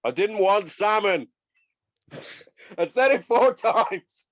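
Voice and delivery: loud, high, drawn-out outrage; mock yell, slow and high, each word stretched; exasperated complaint. Irate diner complaining about the salmon, exasperated.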